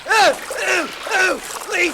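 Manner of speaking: high, loud squeals of revulsion; squeamish, frantic cries; panicked yelping. Acted. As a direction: yelp in panic at something on you. Finds a leech on his neck.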